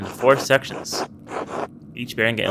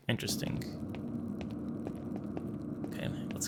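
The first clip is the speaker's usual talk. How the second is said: barely audible whisper to himself; whispered aside; 19 dB quieter, faster than usual. Real, not acted. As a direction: breathe the word out, almost silent. Muttering to himself while exploring.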